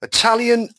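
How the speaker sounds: "Italian" spelled out loud and high; Italian said carefully. Shown as a demonstration. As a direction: sound out "Italian" as if checking it. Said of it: "how can he not know Italian".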